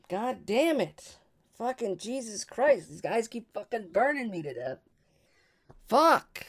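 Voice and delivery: high-pitched, cursing frustration, building to a loud yelp; exasperated outburst. Real, not acted. Player cursing the guys who keep burning him, exasperated. Player's character burned to death.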